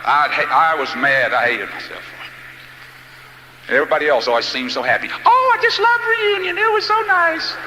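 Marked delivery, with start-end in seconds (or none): 5.2-7.6 high, slow gushing voice, well up in pitch; high, slow mock voice of a reunion guest. Mocking a happy reunion guest.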